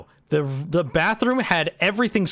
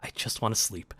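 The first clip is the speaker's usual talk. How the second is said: whisper; 7 semitones lower, 10 dB quieter, faster than usual. Retold or imitated. Exhausted at the hotel desk.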